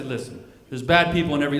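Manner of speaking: mock-profound wisdom; trying to be deep. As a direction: deliver it like a solemn moral. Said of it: "I try to get deep".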